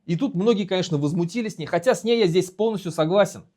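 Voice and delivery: ordinary talk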